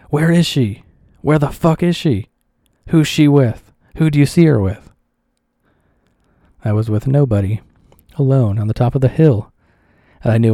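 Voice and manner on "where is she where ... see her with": raised, louder jealous yelling, higher pitched; retold yelling. Jealous boyfriend yelling her name across the boats. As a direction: bellow his search for her, higher and louder.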